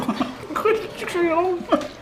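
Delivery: high burst of laughter; fou rire. Real, not acted. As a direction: crack up at your own slogan. Rit de son slogan. (éclat de rire aigu)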